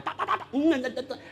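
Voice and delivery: ocehan marah, bernada jauh lebih tinggi; tiruan bunyi marah. Retold or imitated. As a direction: tirukan omelan tak-tak-tak. Pak Jamal marah-marah. (tiruan omelan marah, nada tinggi)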